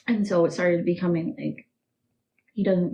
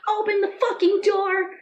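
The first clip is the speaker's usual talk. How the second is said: higher shouting through a locked door; grandma yelling outside the locked room; imitated shouting grandma; 4 dB louder than usual. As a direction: yell to get the door opened. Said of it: "banging on the door".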